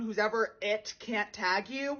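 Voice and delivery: ordinary talk, nothing marked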